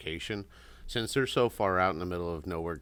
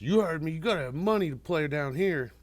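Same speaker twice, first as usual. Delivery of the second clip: higher, louder retold threat; mock tough-guy taunt; 9 semitones higher, 5 dB louder than usual. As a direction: puff up and bark it like a bully. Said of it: "he goes".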